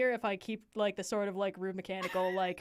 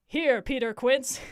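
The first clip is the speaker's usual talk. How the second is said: higher, louder roll-call reply; eager answer; 4 semitones higher, 7 dB louder than usual. Acted. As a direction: call out present to Quince.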